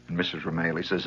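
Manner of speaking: normal talking voice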